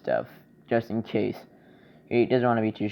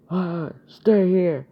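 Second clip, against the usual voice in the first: high, drawn-out mock shout; acted warning shout; 9 semitones higher, 6 dB louder, much slower than usual. Old man warning Deku to keep out of trouble, mock shout. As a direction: call it out high and long.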